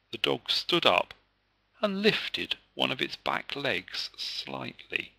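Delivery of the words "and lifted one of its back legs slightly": ordinary delivery, nothing marked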